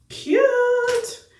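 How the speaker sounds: a delighted high-pitched cute; pleased squeak at the mirror; high squeaky cute. Real, not acted. Admiring her lip liner.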